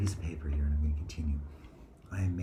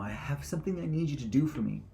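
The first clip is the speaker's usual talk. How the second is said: higher, urgent request; high eager ask; 9 semitones higher, 5 dB louder than usual. Acted. Playing Mashiach, asking a favour in a high voice. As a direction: plead eagerly. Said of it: "repeated quieter".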